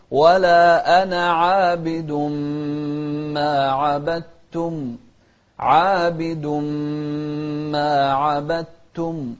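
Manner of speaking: عابد with the long alif held; full madd. Shown as a demonstration. Teacher showing the right length in عابد. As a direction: stretch the alif of عابد fully. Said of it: "شوف المقدار".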